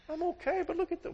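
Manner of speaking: very high, whimpering mock-wife voice; tearful wife. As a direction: squeak it out as an upset wife. Crying wife answers her husband.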